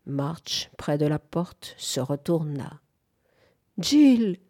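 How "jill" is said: higher, louder cry of the name; passionate cry. A narrator's reading. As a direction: call out as if waking. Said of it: "cria-t-elle, d'une voix passionnée". (cri passionné, plus fort et plus aigu)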